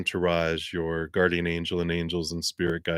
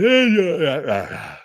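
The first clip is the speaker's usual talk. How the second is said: long audible yawn; real sleepy yawn; 10 semitones higher, 6 dB louder than usual. Real, not acted. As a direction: let a big yawn slip out mid-sentence. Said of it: "as I yawn".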